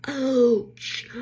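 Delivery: high, loud drawn-out ouch; mock yelp of pain. Recalling that awful rubber swim hat, loud pained yelp. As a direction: cry out as if the rubber hat pinched.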